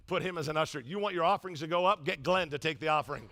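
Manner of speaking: ordinary delivery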